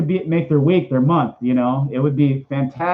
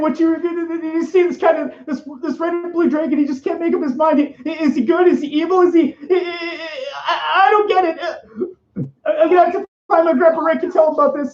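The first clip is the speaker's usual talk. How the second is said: much higher, stammering nervous Morty voice; Morty impression; 12 semitones higher than usual. Streamer does his Morty voice, fretting about a dragon, anxious stammer. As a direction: stutter it out like a frantic, confused teen. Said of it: "an okay impression of Morty".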